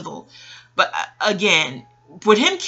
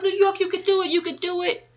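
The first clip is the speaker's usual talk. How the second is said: cheering someone on; 10 semitones higher than usual. Retold or imitated. Replaying how she rooted for the dolphin rider, high-pitched cheering. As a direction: replay your rooting in a high, eager pitch.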